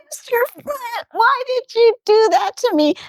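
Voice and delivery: high, put-on pleading voice; complaining casino host. Casino host phoning the big winner, plaintive complaint. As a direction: imitate a distressed host complaining. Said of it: "Larry's host calls him. He goes".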